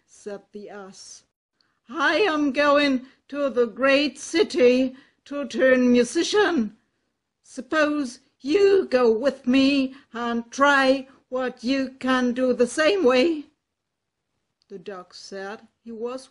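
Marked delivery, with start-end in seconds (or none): 1.9-13.5 loud, bold donkey voice; a confident ass calling out to a friend; hearty donkey. Acted. Ass character speaks.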